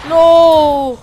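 shouting no. Real, not acted. Sudden shout in game.

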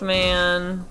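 ordinary talk, nothing marked

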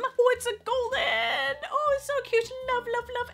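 delighted cooing. Real, not acted. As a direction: coo over something adorable. Cooing over a cute card.

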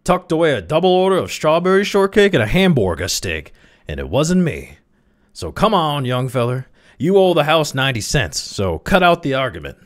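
high, loud bullying accusation; loud declaration. A narrator's reading. Spotter demands ninety cents from Crawley, loud. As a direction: announce it loudly for all to hear. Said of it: "he declared loudly".

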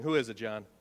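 ordinary talk

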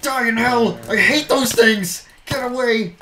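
loud, high frantic shouts; panicked battle cry; furious yelling. Real, not acted. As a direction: yell at the mobs in panic. Fighting off mobs, yelling.

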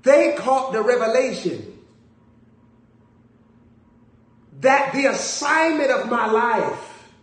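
loud high slow preaching; preaching at full force. Real, not acted. Preacher driving the point home to the congregation. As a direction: build it like a preacher's climax.

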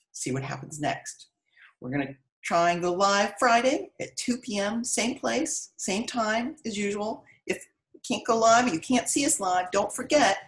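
ordinary delivery

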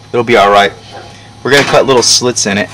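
ordinary delivery

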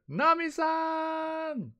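high, long drawn-out shout; dramatic calling out. Acted. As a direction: stretch the name into a yell. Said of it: "then we hear".

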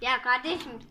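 ordinary talk, nothing marked

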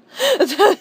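sheepish laugh at her own crush; high embarrassed laugh. Real, not acted. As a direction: laugh it off, a bit flustered. Admits being in love with Usami.

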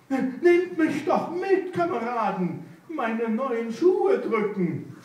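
sly fox wheedling the riders for a lift. Acted. Fox begs a ride.

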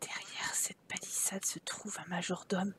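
breathy, voiceless whisper. Pretend. Revealing the hidden butler, mock-mysterious whisper. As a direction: let it out like a secret. Secret butler behind the fence.

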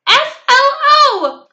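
very high, slow, loud spelling; thrilled squeal, letter by letter; real thrilled. A narrator's reading. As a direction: shout each letter with childish glee. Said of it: "I spelled real thrilled".

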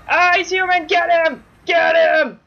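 high, loud rallying yells; rally everyone to attack, yelling; excited battle shouting. Real, not acted. Player sending the team at Herobrine, excited shouting. Cheering team to kill monster.

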